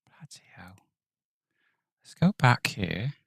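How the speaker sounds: playful put-on British accent, half-whispered; hammed-up British slang in a mock British accent. Vlogger keeps up a jokey British accent while working. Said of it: "I gotta go fully, you know, British accent".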